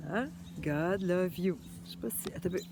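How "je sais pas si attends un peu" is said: hushed to self. Real, not acted. Fumbling with the camera.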